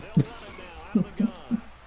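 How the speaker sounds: high, airy laughter; breathless laugh. Real, not acted. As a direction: crack up, breathless. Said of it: "I'm dead".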